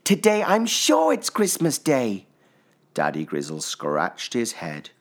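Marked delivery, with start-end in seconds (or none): 0.0-2.3 put-on child voice. A narrator's reading. Little Pip, eager cub.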